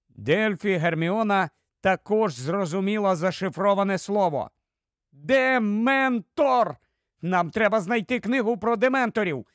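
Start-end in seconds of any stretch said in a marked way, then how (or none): 5.1-6.8 high, drawn-out exclamation; exclamation of discovery. Acted. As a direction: call out the answer slowly and high. Solves the riddle, exclaims.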